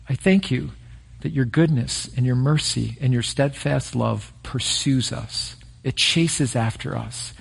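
hushed pastoral prayer. Acted. Leads a closing prayer.

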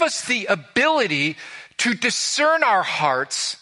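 preacher's soaring hope; fervent preaching. Real, not acted. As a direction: lift the room with the promise.

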